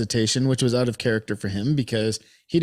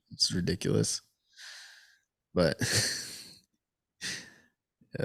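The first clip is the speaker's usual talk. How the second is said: ordinary talk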